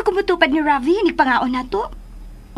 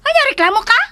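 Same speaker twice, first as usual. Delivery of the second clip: sharp challenge; 7 semitones higher, 5 dB louder, faster than usual. Acted. Challenging a complaint.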